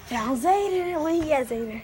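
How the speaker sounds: loud excited call; excited shouting. Real, not acted. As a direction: shout with glee. Found a hider.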